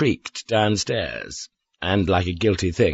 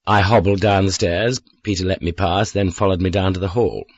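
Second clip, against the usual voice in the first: ordinary delivery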